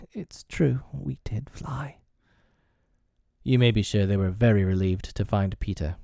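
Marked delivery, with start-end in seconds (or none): 0.1-2.0 slow, whispery drowsy mumble; sleepy mutter. A narrator's reading. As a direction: mutter it half asleep, trailing into a whisper. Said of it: "rub his eyes and mutter".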